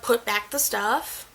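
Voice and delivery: normal talking voice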